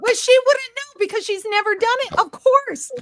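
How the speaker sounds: high, loud indignant rant; furious disbelief; real outrage. Real, not acted. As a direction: let the anger burst out high. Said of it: "I'm so mad".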